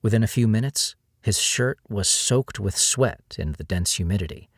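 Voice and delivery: ordinary delivery, nothing marked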